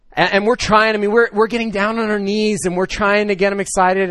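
put-on animated and loud, wooing a little boy; eager excitement. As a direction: pump up the eagerness as if coaxing a shy child. Eager adults coaxing a child.

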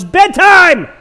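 high, loud call up the house; yelled bedtime. Retold or imitated. Parent yelling at kids to go to bed, loud shout. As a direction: bellow it at the kids. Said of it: "yell at the top of your lungs".

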